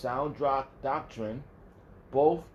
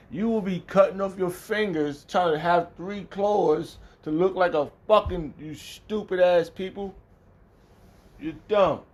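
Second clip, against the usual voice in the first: loud, raised, scathing outburst; angry insults; 6 semitones higher, 6 dB louder than usual. Real, not acted. Preacher scolding stupid people who copy Satan, loud angry rant. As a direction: berate them, stretching out the insults.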